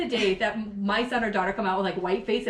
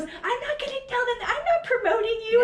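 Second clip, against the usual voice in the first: mock daughter; 13 semitones higher than usual. Daughter's indignant reply retold.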